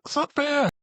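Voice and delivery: high-pitched protest; hammed-up high whining objection; mock indignant whine. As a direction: imitate an indignant complaint, pitch way up. Acting out a sexual complaint.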